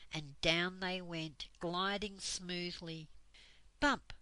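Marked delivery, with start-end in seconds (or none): 3.8-4.1 loud bump. A narrator's reading. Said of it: "They reached the grass".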